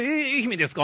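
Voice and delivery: surprised, high-pitched question; retold eager double-check; growing excitement. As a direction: ask it with rising excitement. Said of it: "徐々にこうテンション上がってくる".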